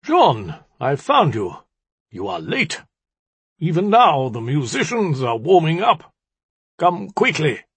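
high-pitched, theatrical summons; disrupted orb voice. A narrator's reading. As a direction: call out urgently through a magic orb. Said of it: "A voice issued forth, similarly disrupted".

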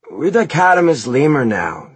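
loud, high, slow dude voice; self-important bandmate Eric. A narrator's reading. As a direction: announce it as a garage-band frontman. Plays Eric in the story.

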